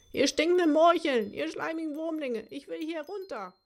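high squeaky insults dying into the distance; high angry cursing, fading out; fading far-off cursing. Acted. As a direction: rant as if left far behind. Stranded monster cursing, fading away.

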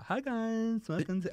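high, soft chirpy welcome voice; perky usher greeting; cinema host voice. Retold or imitated. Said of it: "It is that voice".

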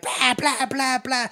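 high-pitched repeated mock blasts; vocal gun noises, evenly fired; imitated rifle fire. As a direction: bark out rapid shots with the mouth. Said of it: "Charlie's like unloading this rifle".

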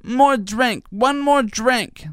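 put-on high, very slow little-kid begging; toddler voice. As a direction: stretch it out like a kid stalling bedtime. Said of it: "And he'll say".

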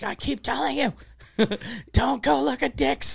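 high, half-voiced mock telling-off; mock yelling mom. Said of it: "her mom started yelling at her".

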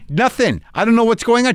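retold exasperation, pitched high; exasperated complaint. Renter back at the counter with keys that fail, exasperated. Acted quarrel at rental counter.

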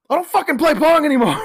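high, loud put-on shout; hammed-up Barry outburst. Barry refusing to play Pong, shouting high and loud. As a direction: blow up in a mock rage. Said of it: "Barry's just like".

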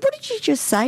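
high-pitched disbelief; retold shocked mother. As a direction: challenge the cheeky child, scandalised. Retold shocked mother to cheeky son.

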